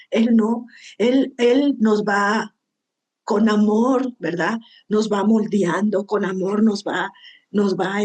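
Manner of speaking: ordinary talk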